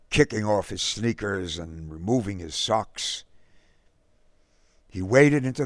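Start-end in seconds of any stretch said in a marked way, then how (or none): none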